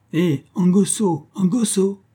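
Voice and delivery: loud high call across the camp. Acted. Nicolas hailing Angosso from a distance, loud high call. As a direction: call Angosso over from afar.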